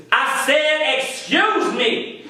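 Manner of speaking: high-pitched, drawn-out "excuse me"; slow, emphatic mock demand; imitated indignant repeat. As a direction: stretch it out, insisting on being heard. Passerby repeats herself, insisting.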